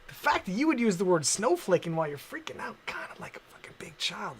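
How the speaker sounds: normal talking voice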